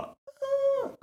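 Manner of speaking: very high wordless protest; a child's outraged wail; frustrated kid's wail. Retold or imitated. Kid protesting when the TV is switched off, high wail. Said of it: "Stäng av T V:n och bara".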